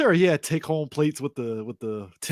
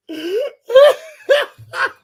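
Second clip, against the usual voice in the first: loud high laugh; 11 dB louder than usual. Real, not acted. Big laugh at the joke.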